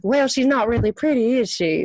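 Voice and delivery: a polite Southern put-down, lightly drawled. Retold or imitated. The same catty line in a lightly voiced Southern accent. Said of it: "a Southern person may be like".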